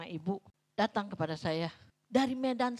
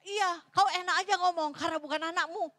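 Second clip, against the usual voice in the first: mother's bitter retort; 7 semitones higher, 5 dB louder than usual. Retold or imitated. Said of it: "Jadi dia bilang".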